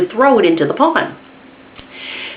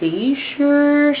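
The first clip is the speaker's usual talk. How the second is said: timid question; 10 semitones higher, slower than usual. Retold or imitated. Scared child doubting.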